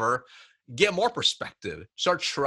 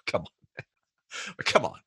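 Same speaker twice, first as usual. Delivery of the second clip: laughing protest; 6 dB quieter than usual. Retold or imitated. Laughing disbelief at teammate's boast.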